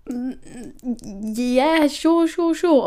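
high, nervous, rising eager agreement; squeaky flustered yes. Retold or imitated. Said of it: "So I was like".

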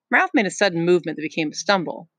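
ordinary talk, nothing marked

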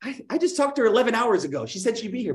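hammed-up frustrated agent; indignant. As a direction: protest she promised to be home. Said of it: "And you're like".

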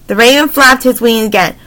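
plain reading voice

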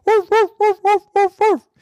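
high loud yappy woofs; barking dog. Acted. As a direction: bark like an excited little dog. Dog Watson barks.

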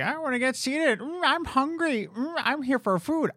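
high, whiny mock customer; hammed-up hungry customer voice. Imitating impatient customers.

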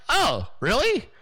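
drawn out in disbelief; surprised oh really. Retold or imitated. Hearing the campaign loves his show, surprised. Stunned by the compliment.

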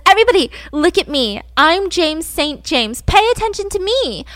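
playfully hammed-up dinner-party speech; mock attention-seeking announcement. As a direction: imitate someone demanding everyone's attention. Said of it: "stands up in front of a crowd of people and says".

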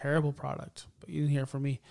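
ordinary delivery

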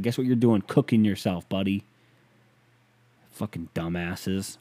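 ordinary talk

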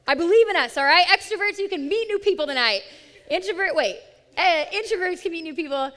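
pep up the whole room, loud and high; loud, upbeat group-leader call. Real, not acted. Rallying the room before group discussion.

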